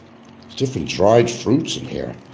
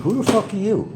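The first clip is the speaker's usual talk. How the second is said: higher mock snarl; retold demand; 13 semitones higher than usual. At the fan at his door, retold high challenge. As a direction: throw it at the stranger, higher. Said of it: "Those are my words".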